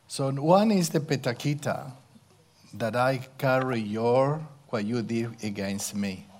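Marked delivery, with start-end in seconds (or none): none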